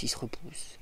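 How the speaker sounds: breathy whispered repeat; whispered echo. Real, not acted. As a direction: let it fade to a whisper.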